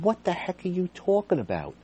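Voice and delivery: shocked question to the superintendent; stunned disbelief. Retold or imitated. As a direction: sound floored by what she just said. Baffled by old incident mention.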